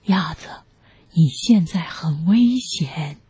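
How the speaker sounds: grave whispered warning; low whisper. A narrator's reading. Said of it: "大熊低声说".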